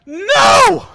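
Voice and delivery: put-on high, loud child's "No!"; kid refusing. Child refusing dad the Skittles, high shouted no.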